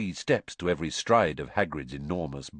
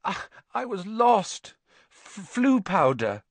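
put-on higher, halting young boy's voice, slow; shaken boy; 11 semitones higher than usual. A narrator's reading. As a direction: stammer it out like a lost, shaken kid. Lost boy stammers to Hagrid.

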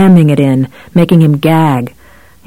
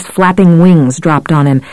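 ordinary delivery

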